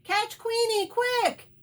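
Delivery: high, urgent shout; rally everyone at the top of your voice; loud quacking. A narrator's reading. Duck alerts other animals to chase dog, loud quacking. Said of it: "hear Dottie's loud quacking".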